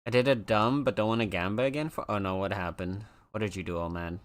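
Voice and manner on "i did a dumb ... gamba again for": usual speaking voice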